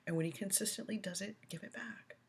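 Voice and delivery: soft whisper fading out; whispered advice. Real, not acted. Advising a viewer on disciplining the grandson. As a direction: lean in and whisper it. Parenting advice, dropping low.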